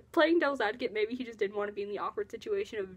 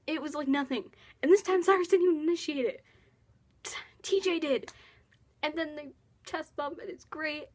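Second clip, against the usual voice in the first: gushing; 5 semitones higher than usual. Real, not acted. Fan telling viewers about the hug, gushing. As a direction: rave about it like a thrilled fan.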